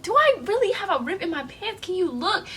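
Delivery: high-pitched, pleading question; worried asking. Retold or imitated. Asking a friend to check her pants, worried disbelief.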